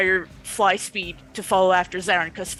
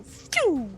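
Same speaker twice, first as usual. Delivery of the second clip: whoosh sound effect; 7 semitones higher than usual. Acted. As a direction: mouth whoosh of flying past. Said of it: "I love the Foley work".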